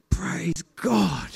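breathy whisper under the breath; whispered praise. Retold or imitated. Whispering thanks behind the chanting crowd. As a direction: whisper it so no one hears. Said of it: "I'm just like".

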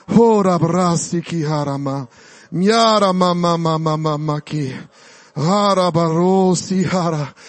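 low, murmured tongues, slowing at the end; speaking in tongues. Acted. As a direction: pray in tongues under the breath. Praying in tongues.

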